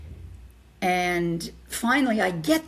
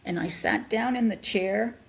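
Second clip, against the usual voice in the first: ordinary talk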